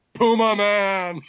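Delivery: high, drawn-out mock holler; Mike's mock shout. Fan repeats riffer's joke over dangling hero, imitated drawn-out shout. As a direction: stretch it out like a heckler's call. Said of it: "Mike's like".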